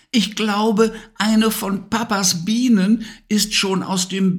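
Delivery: put-on high child voice, calling out. A narrator's reading. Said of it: "rief er etwas lauter".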